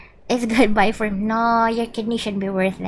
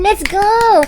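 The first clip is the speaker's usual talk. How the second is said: high, loud cheer; excited cheer; 11 semitones higher, 9 dB louder than usual. Real, not acted. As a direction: hype the gaming phone up. Hyping gaming phone.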